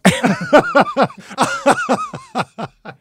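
cracking up with a shrill laugh; loud high laugh. Real, not acted. Bursts out laughing at partner.

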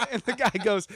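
laughing through the setup; chuckling as he tells it. Real, not acted. Telling a friend about the ride operator, laughing.

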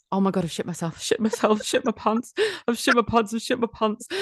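high, frantic repeating; panicked. Retold or imitated. Groggy patient realising her accident, high frantic panic. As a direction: spiral into horrified panic. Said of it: "like this".

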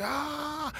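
long high-pitched "ah", held back; mock cry of burning up; overwhelmed cry. Intern burning up under the power, overwhelmed cry. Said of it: "because I was so hot".

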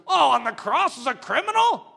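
loud, high scoffing question; incredulous mockery, pushed up high; mocking crowd. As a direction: jeer it like a scornful onlooker. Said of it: "everyone laughs and goes".

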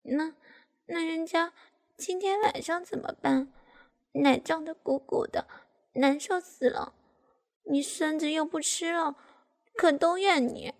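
high, wheedling complaint; coaxing whine. A narrator's reading. Daughter complains to her father, coaxingly. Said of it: "撒娇地对爸爸说".

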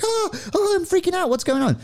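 squeaky, very high retold panic; mock freak-out. Recalling her own big moment, squeaky mock panic. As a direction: freak out playfully in a tiny high voice. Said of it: "one of those moments where I was like".

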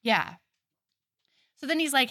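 ordinary delivery

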